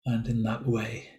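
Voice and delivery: measured meditation guidance with long, silent pauses; guided meditation. Real, not acted. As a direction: leave space after each phrase, guiding listeners into body awareness. Leads a silent-day meditation.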